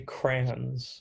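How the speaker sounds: "crayons" drawn out slowly as crans; one-syllable crayons. Shown as a demonstration. Friend owns up to their pronunciation, one-syllable crayons. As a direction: say "crayons" like cran in cranberry. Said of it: "I say crayons".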